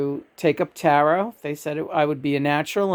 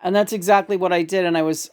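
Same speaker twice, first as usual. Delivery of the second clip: usual speaking voice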